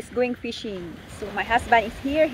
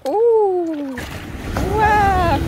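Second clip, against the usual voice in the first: excited ooh; 7 semitones higher, 7 dB louder than usual. Real, not acted. Amazed at catch.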